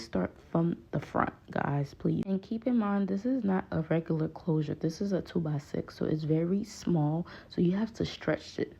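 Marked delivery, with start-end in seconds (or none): none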